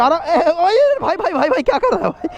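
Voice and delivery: high, urgent calls of "bhai" to get attention; calling out. Real, not acted. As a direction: shout up at him to stop what he's doing. Biker calling out to another rider.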